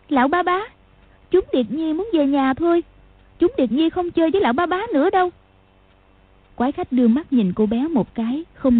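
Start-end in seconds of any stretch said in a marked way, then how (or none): 0.1-5.3 put-on high child's voice, last line fast; a small girl pleading to go home; girl asking the strange man to let them go; little girl. A narrator's reading.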